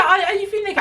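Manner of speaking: high, hurried mock question; high-pitched mimicry. Customer questioning the upset worker, high and exaggerated. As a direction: rattle it off up high. First take, rattled off high.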